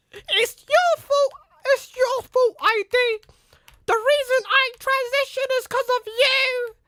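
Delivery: mock squeaky accusation. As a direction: whine it in a mocking squeaky voice. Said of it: "his squeaky voice from high school".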